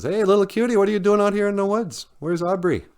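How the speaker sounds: high-pitched, quick, chummy hail; fox character greeting. Acted. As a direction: call out to a little pal in a high voice. Fox greets little cutie in story.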